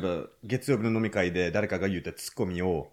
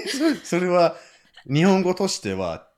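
mock correction; 6 semitones higher, 4 dB louder, slower than usual. Friends correcting him.

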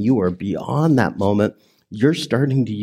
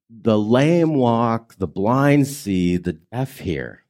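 slow solemn quote; slower than usual. Acted. Preacher quoting Jesus solemnly.

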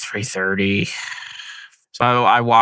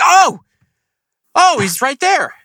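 sudden high, loud "Oh!" of surprise; startled gasp; 4 dB louder, slower than usual. Retold or imitated. Hunter spots the turkey right at his feet, startled. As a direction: jump at the sight of the bird.

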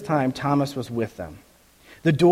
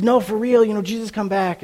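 insisting; 8 semitones higher than usual. Retold or imitated. Disciples insisting to doubting Thomas.

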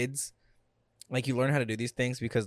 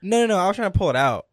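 high loud protest; excited denial; 8 semitones higher, 10 dB louder, faster than usual. Real, not acted. Denies turning the volume.

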